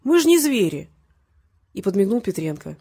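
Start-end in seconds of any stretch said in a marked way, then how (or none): 0.0-0.9 high, loud mock reproof; reproachful. A narrator's reading. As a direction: chide her with exaggerated protest. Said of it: "укоризненно протянул Валерий". (укоризненно, с повышением тона)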